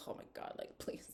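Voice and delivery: hushed, voiceless plea; exasperated whisper. Acted. Host irritated by their flattery, exasperated whisper. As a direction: whisper it as if worn out by them.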